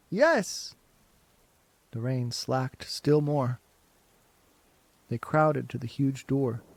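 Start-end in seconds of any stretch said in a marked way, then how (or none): 0.1-0.7 put-on high chorus of children; children answering. A narrator's reading. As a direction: chirp it like kids. Class answering together.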